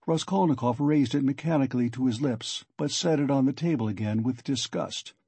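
usual speaking voice

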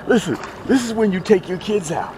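high, loud and thrilled; carried away by the catch. Real, not acted. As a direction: burst out with it, pitched well up. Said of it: "I gotta lower my voice".

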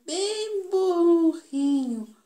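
high, slow mocking sing-song. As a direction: tease them with a drawn-out jab. Mocking the donkey. (zombando devagar)

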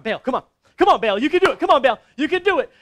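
loud, high urging, building in speed; frantic cheering on. Acted. Prophets jumping around urging Baal to act, frantic cheering. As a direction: egg the idol on excitedly. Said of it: "then they started jumping around".

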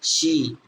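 সি said as "shee"; pronunciation of সি. Shown as a demonstration. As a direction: sound out সি as one long syllable. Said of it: "S এ চাই কি হবে?".

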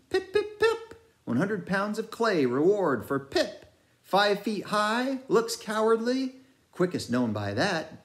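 high, sing-song crier voice; mad bellboy crier. A narrator's reading. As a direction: ring out like a mad ship's crier. Pip's mad bellboy chant.

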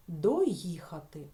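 teacher isolating the word; slow emphatic demo word. Shown as a demonstration. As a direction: drag out Доїхати for the class. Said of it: "Чи можемо ми ось тут вставити слово?".

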